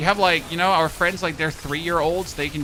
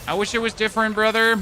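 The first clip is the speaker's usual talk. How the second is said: louder, higher, sorry brother; raised voice, sympathetic; loud regret; 7 semitones higher, 5 dB louder, faster than usual. Real, not acted. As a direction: call out the bad news loud.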